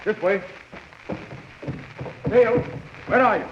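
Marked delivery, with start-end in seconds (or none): 2.3-3.4 calling out. Acted. Rescuer searching for the missing girl, urgent call. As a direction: call her name across the ship.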